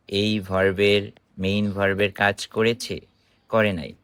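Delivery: usual speaking voice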